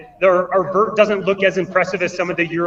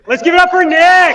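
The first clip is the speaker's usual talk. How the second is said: loud, high hype call; rally the crowd for the winner; 10 semitones higher, 10 dB louder than usual. Real, not acted. Host rallying crowd at finish.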